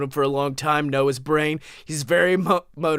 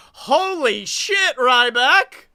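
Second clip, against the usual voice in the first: much higher slow exclamation; amazed; 7 semitones higher, 5 dB louder, much slower than usual. Real, not acted. Reacting to the wrestler's news, amazed. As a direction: blurt it out in disbelief.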